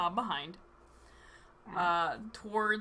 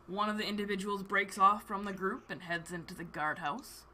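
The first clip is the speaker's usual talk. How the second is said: ordinary talk, nothing marked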